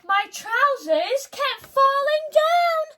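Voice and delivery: high, loud, slow dismay; shocked giant. Acted. George realises his trousers keep falling down, high and aghast. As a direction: gasp then wail it out. Gasp, then high alarm.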